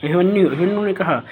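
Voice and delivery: usual speaking voice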